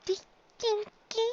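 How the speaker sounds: very high, soft little voice with a catch; timid filly. Acted. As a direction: squeak out your name, frightened. Scared filly gives her name.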